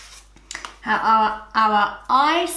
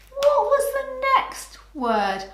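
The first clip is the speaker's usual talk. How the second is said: teacher prompt; 14 semitones higher than usual. Shown as a demonstration.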